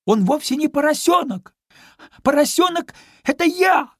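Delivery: very high, loud piglet squeal; shouting after. A narrator's reading. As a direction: shout the correction at the departing dog. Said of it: "закричал ей вслед Чуня". (кричит вслед, громко и пискляво)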